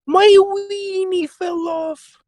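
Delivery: put-on very high, slow falsetto old lady wail; a creaky old woman pleading, stretched out. Comic bit to his co-host in a squeaky old-woman voice.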